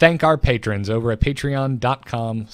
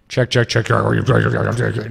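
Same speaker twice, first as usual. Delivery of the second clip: echoey flange effect on a mic check; 4 dB louder, much slower than usual. Shown as a demonstration. Podcaster trying out a sound effect for co-host, echoey. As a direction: test the mic through an echoing flanger. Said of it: "it's just kind of echoey".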